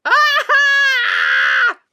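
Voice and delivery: loud high shriek. Acted. Dirty Ulf being scrubbed, loud high shriek. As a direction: yelp as the soap hits.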